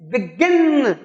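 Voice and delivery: Begin with a strong, falling गिन; teacher's demo of begin, weight on गिन; stress on second syllable. Shown as a demonstration. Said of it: "गिन पे जोर है".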